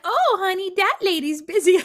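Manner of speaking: put-on high, cooing baby-talk voice; sugary baby talk. Mother on the trail coos at her child in high baby talk. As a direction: talk to the kid as if to an infant. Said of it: "I had to do a little bit of it".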